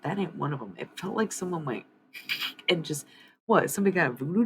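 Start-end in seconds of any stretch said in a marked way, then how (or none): none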